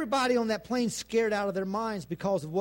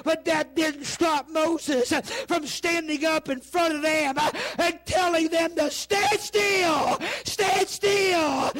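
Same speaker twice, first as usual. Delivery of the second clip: high, strained, full-force sermon cry; impassioned preacher at the peak; shouted preaching climax; 10 semitones higher, 5 dB louder, slower than usual. Real, not acted. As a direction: cry it out to the congregation at fever pitch.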